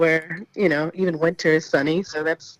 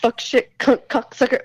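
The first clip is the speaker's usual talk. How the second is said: pretend-mad cursing; 5 semitones higher, 4 dB louder than usual. Guest cursing on the host's request, playfully mock-angry. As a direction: rattle off curses in mild mock anger, a bit higher. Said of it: "if you were really mad at someone".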